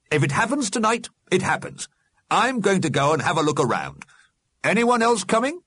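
high, brisk, resolute voice; rally the others to follow; defiant Alderman. A narrator's reading. The Alderman invites the dead to explore, defiant.

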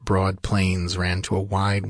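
ordinary delivery, nothing marked

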